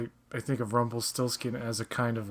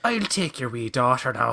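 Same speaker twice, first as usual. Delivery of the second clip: mock Irish leprechaun. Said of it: "It's very leprechaun-y".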